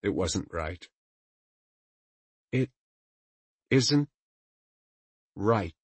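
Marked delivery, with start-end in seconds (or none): 2.5-5.7 forced out through clenched teeth; through gritted teeth. Acted. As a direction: spit it through your teeth, isn't pushed high. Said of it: "she said through her teeth".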